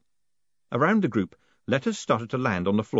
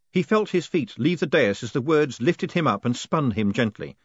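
ordinary delivery, nothing marked

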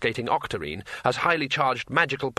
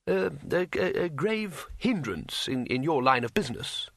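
halting arch astronomer; 4 semitones higher than usual. A narrator's reading. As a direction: hesitate delicately as a sympathetic ruler.

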